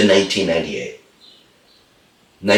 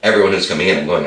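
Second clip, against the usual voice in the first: ordinary talk, nothing marked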